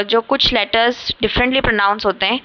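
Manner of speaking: ordinary delivery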